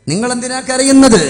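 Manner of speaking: high, loud intoned cry. Retold or imitated. Wife asks Ali why he weeps, high intoned cry. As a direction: swell upward and ring the question out. Repeats question in raised chant.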